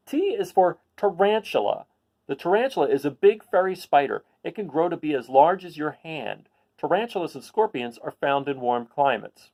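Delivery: picture-book read-aloud voice; reading to children. Acted. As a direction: read it to a class of kids.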